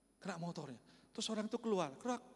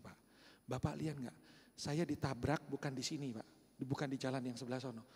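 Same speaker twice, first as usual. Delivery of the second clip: lower, softer, patient reasoning; calm explaining; 6 semitones lower, 3 dB quieter than usual. Retold or imitated. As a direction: keep it even and polite. Pastor calmly explains to angry man.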